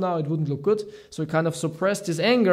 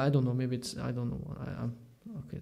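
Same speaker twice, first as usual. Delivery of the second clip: ordinary delivery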